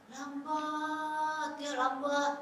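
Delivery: slow as a turtle, long level vowel; word dragged out lazily; drawn-out slow. Shown as a demonstration. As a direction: stretch the word out on one held note. Imitating a turtle's slow pace.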